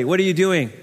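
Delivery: normal talking voice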